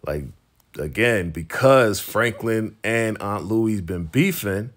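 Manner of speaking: ordinary talk, nothing marked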